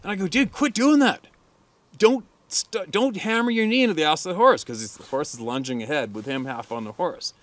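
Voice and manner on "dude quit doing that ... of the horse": raised, higher-pitched exasperated warning; retold scolding. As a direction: scold a careless rider, loudly. Scolding a rider in retold story.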